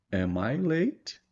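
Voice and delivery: "am I late?" said very slowly with a rising question tune; question intonation. Shown as a demonstration. Teacher showing how the voice changes in a question. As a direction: let "late" rise like a real question. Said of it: "a entonação de voz também muda".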